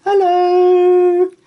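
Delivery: high, loud calling from the shadows; a spooky voice calling out hello; ghostly call. A narrator's reading. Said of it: "a voice called".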